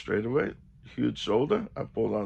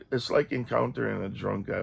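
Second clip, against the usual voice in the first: ordinary talk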